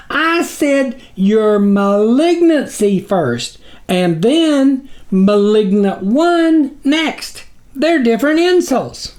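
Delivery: slow, high squeaky protest; small figure pleading high and slow; frantic tiny creature. A narrator's reading. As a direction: insist earnestly, drawn out. Snuffer explains, dodging.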